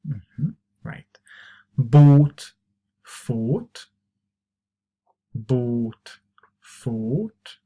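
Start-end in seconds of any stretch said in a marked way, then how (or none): 1.8-7.6 "Bought" and "fought" with a long "aw"; "Bought", "fought" drawn out as word drill; long vowel. Shown as a demonstration. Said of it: "Remember, it's long".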